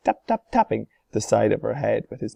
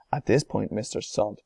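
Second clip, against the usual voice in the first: ordinary delivery, nothing marked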